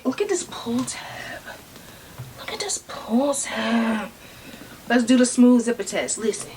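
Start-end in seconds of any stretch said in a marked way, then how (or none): 0.0-1.4 awed whisper. Real, not acted. Admiring the pull tab. 2.4-4.1 awed whisper. Real, not acted. Admiring the pull tab.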